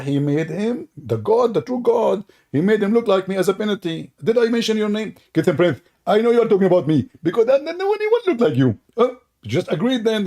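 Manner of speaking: high, loud put-on voice of the rival; hammed-up whiny protest; mock rival voice.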